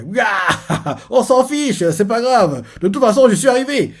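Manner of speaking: laughing Garp. Acted. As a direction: brush it off with a laugh. (Garp qui rigole, voix plus aiguë)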